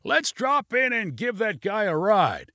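high, slow, stretched call; shouted suggestion. A narrator's reading. Pilot proposes giving a lift, high mild shout. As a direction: call it out high, taking your time. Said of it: "shouted Junie".

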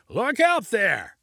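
high, drawn-out excited call; excited cry. A narrator's reading. Pilot points out a sight below, excited cry. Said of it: "Junie cried with excitement".

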